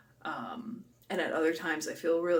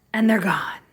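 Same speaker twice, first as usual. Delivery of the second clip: playful whisper; 2 semitones higher, 10 dB louder than usual. Real, not acted. Playful aside about dishes.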